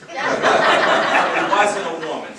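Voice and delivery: loud, slow words through laughter; real laughter bursting through the line; laughing. Real, not acted. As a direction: laugh it out at your own joke. Joke about knocking someone over.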